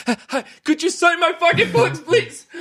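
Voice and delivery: high, far squeaky mock nerves with a laugh; nervous fan. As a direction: ham up a jittery fan blurting at an artist. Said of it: "this year I don't look as nervous".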